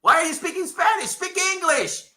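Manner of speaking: high-pitched exasperated demand; baffled protest. Retold or imitated. Wrestling fan reacting to a Spanish promo, baffled and high-pitched.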